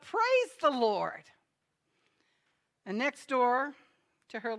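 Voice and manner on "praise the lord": drawn-out, high praise shout; loud, slow proclamation; joyful shout. Retold or imitated. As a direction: shout it from the porch, stretched out. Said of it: "and shout out".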